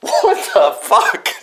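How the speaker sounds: high, slow, drawn-out what the fuck; stunned disbelief. Retold or imitated. Reacting to the old truck starting, high slow disbelief. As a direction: let the shock stretch every word. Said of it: "I'm like".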